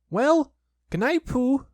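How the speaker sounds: high, small piglet voice. Acted. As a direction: squeak it up like a tiny piglet. Piglet's voice in story.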